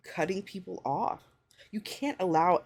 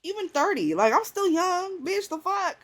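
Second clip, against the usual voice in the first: indignant outburst; 12 semitones higher, 4 dB louder than usual. Real, not acted. Indignant about being young.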